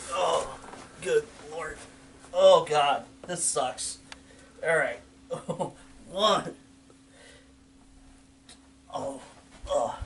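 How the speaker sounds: straining mid push-up; breathy grunting effort; doing push ups. Real, not acted. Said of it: "You doing all right there?".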